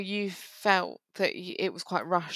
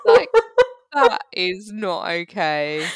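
slow, loud, deliberate disapproval; mock outrage; 12 dB louder, slower than usual. Real, not acted. Cohost protests the [name] guess, slow and mock-stern. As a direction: spell out each word like it's a crime.

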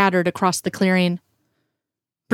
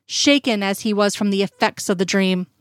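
plain reading voice

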